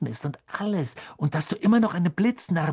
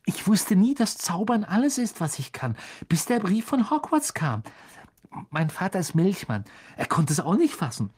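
put-on high, breathless-with-joy boy fan; gushing excitement; thrilled; 8 semitones higher, 3 dB louder than usual. A narrator's reading. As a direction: bubble over with enthusiasm, high-pitched. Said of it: "holte vor Begeisterung tief Luft".

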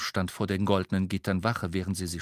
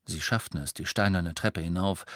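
ordinary delivery

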